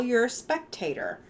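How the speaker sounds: ordinary delivery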